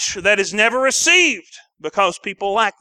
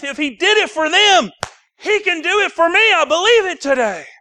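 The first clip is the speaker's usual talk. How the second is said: full-force preaching; 7 semitones higher than usual. Acted. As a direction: preach it with rising fire.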